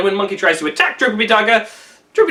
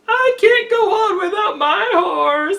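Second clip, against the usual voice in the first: high, slow, wailing sob-voice; crying; 11 semitones higher, slower than usual. Acted. Tripitaka weeping to Monkey over his eaten horse, high, slow and tearful. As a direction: blubber it out like a helpless monk. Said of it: "he begins to cry again".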